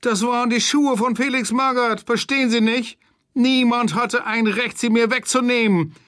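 hohe, jammernde Stimme; weinerlich jammernd. Acted. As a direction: wie ein gekränktes Kind quengeln. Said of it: "jammerte er mit weinerlicher Stimme". (verhafteter Kalli jammert den Kommissaren hoch und weinerlich vor)

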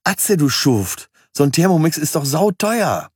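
a girlfriend mock-scolding Atze over an expensive gift; playful girlfriend. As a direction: play the delighted girlfriend scolding him fondly. Girlfriend teasing him in story.

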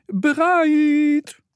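very high, fast ant squeak; tiny ant police calling. Acted. Ant police announcing themselves to Mo, squeaky call.

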